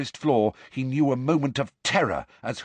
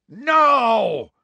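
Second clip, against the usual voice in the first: loud, high-pitched roar of refusal; 11 semitones higher, 7 dB louder than usual. Acted. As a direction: roar it out in one long cry. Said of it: "roared a voice in Harry's ear".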